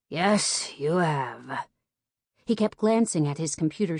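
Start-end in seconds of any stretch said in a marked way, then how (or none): 0.1-1.7 ragged, breathy and worn out. A narrator's reading. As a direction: exhale it raggedly, barely voiced. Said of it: "James replied in a ragged, breathy voice".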